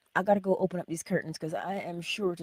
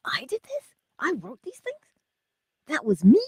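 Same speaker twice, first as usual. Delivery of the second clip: much higher, rising disbelieving questions; incredulous friend; 10 semitones higher, 8 dB louder than usual. Retold or imitated. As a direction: play the friend's stunned disbelief.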